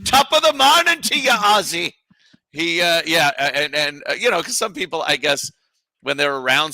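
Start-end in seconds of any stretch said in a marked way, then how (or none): 0.1-1.9 high, hammed-up Irish lilt; exaggerated stage-Irish greeting; mock Irish accent. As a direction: parody him as a cheery Irishman. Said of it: "He turned Irish".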